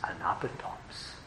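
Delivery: hushed, almost unvoiced; whispered aside. Preacher to congregation, hushed aside. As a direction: drop to a breathy whisper.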